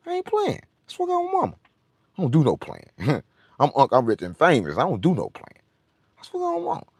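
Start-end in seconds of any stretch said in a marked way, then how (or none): 0.1-0.6 I ain't playing squeaked way up high; a silly high-pitched take; high falsetto. Shown as a demonstration. 0.9-1.5 squeaky high mock voice; a goofy high-pitched riff; high falsetto. Playful put-on voice riff. 6.2-6.9 squeaky high mock voice; a goofy high-pitched riff; high falsetto.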